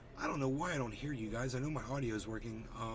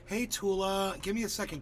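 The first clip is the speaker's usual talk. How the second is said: higher, louder happy hello to a viewer; cheery greeting; 7 semitones higher, 4 dB louder than usual. Real, not acted. Greeting a chat regular, upbeat and higher. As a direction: call out a bright welcome.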